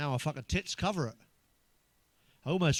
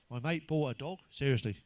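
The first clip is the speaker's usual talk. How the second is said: normal talking voice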